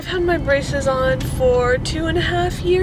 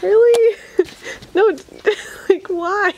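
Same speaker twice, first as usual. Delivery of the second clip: high, loud disbelieving question; incredulous; 9 semitones higher, 5 dB louder than usual. Real, not acted. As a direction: react in disbelief. Reacting to Barney toy.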